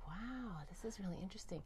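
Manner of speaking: soft, breathless wonder; the three boys murmuring in awe, whispered; awed whisper. Retold or imitated. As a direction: hush as if spellbound. Nephews hooked on the story.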